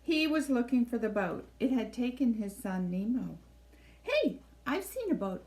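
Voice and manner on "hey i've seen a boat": lightly voiced eager Dory, higher; cheerful helpful fish chiming in; a hint of Dory. Dory eagerly offering help to a panicked Marlin.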